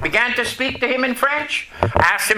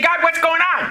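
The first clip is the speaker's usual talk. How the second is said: pitched up, urgent cry to God; panicked driver in the rain cries out to God, alarmed; alarmed plea; 6 semitones higher than usual. Retold or imitated. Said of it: "and I'm saying".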